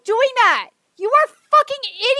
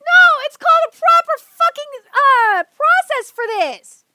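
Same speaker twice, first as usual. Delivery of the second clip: louder, higher yelling; angry shouting; 7 semitones higher, 5 dB louder than usual. Real, not acted. Streamer yells at fans demanding a worldwide release, loud. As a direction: shout them down.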